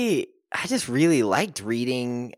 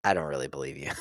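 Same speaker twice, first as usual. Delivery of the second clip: much lower, softer dry retort; deadpan disbelief; 9 semitones lower, 9 dB quieter, faster than usual. Retold or imitated. Answering boastful friends with a low, soft, dry retort. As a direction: flatly call their bluff.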